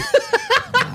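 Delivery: high laughing burst; burst of laughter. Real, not acted. Laughing at the story.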